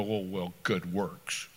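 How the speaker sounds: low, soft scoffing imitation of the self-righteous; low mock scoff of a self-righteous churchgoer; dismissive mockery. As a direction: brush it off with a mock sniff. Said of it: "self-righteous religious people go".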